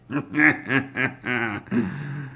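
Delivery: villain's staccato chuckle; gloating chuckle. Acted. Todd laughs once Pete leaves crying, gloating. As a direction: chuckle to yourself like a scheming boss.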